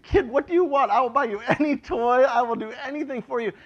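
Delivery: high, loud doting offer; doting eager. Retold or imitated. Pastor imitates himself touched by a church kid's drawing, high and gushing. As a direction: spoil the kid with your voice. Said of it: "It's like".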